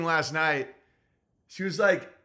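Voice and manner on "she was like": ordinary talk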